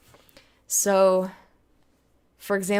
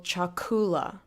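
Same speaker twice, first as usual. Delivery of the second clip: "chakula" stressed on the middle syllable, ku; second-to-last syllable stress; slower than usual. Shown as a demonstration. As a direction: say "chakula" as cha-KU-la. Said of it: "always placed on the second to last syllable".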